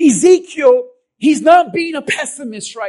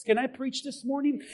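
normal talking voice

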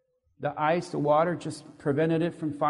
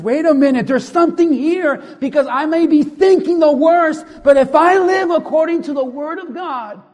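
higher and much louder, breaking out in sudden revelation; excited preaching shout; 8 semitones higher, 11 dB louder than usual. Real, not acted. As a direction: shout it out like the truth just hit you. Sudden preaching realization, full force.

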